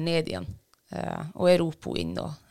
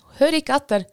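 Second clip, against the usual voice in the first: exasperated, raised repeat; louder emphatic repeat; 8 semitones higher, 6 dB louder than usual. Real, not acted. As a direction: repeat it louder and higher, stressing each word. Repeats after "Hæ?.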